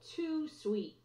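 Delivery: plain reading voice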